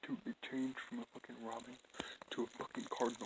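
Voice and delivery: hushed unvoiced whisper. Real, not acted. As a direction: whisper so the bird stays. Whispering near the bird.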